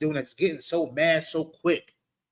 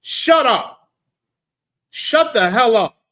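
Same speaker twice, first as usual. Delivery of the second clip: put-on loud, harsh parent yelling at a kid; imitating angry parent; 5 semitones higher, 8 dB louder, slower than usual. Preacher mocks parents yelling shut up at children. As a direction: bark it like a fed-up parent. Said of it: "That's what we do to our children".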